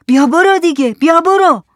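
high, loud shooing away; shouting. Acted. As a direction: shout to drive them off. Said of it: "هم داد زد". (داد زدن برای راندن کسی)

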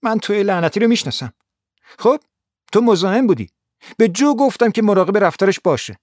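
a touch of a furious blonde; angry. A narrator's reading. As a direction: snap at him angrily. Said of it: "با عصبانیت به من گفت".

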